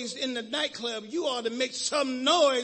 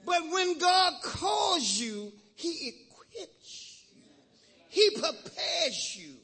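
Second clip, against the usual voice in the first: preaching climax; 5 semitones higher, much slower than usual. Acted.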